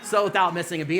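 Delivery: usual speaking voice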